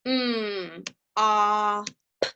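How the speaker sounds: sounding out m, a, p again; segmented sounds. Shown as a demonstration. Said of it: "Let's try that again".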